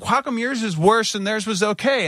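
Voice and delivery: high, bewildered question; baffled demand; incredulous. Retold or imitated. Questioning staff in disbelief.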